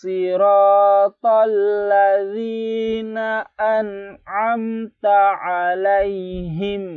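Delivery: high, loud, slow chanted tilawah; Quran recitation. Shown as a demonstration. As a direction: chant the verse out fully and slowly. (tilawah tinggi, keras dan pelan)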